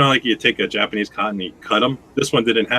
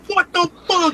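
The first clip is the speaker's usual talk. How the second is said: mock outraged yelp, pitched way up; shocked yelp. Coworker burnt by spitting juice, high shocked yelp. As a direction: imitate his stunned cry of pain.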